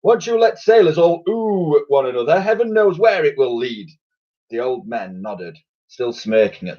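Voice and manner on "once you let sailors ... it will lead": high pompous admiral rant; blustering admiral. A narrator's reading. Admiral keeps ranting.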